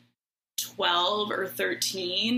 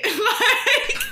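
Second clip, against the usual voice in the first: big high burst of laughter; loud laugh; 12 semitones higher, 5 dB louder than usual. Real, not acted. Telling friends the date's hurtful behaviour, bursting into a high laugh. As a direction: crack up at your own story. Hurtful date story punchline.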